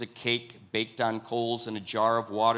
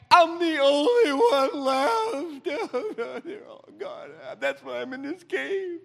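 much higher, hammed-up sobbing whine; mock whining Elijah; 14 semitones higher, 4 dB louder than usual. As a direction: wail like a self-pitying prophet, very slow on only one left.

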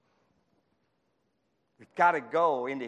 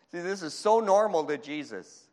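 ordinary delivery

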